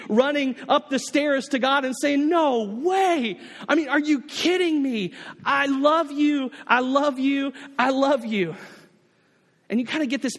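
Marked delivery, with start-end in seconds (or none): 2.3-8.5 joyful outburst. Pretend. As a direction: pour out overjoyed love like a thrilled child. Believer running to God.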